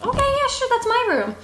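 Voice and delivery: bright high mock girl; high-pitched imitation of the guest; high girly voice. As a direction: squeak it like a clueless girl. Girl's voice in retold exchange.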